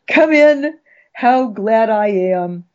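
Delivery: high, bright come in; hearty welcome =68; gusto welcome. Acted. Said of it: "welcoming March with gusto".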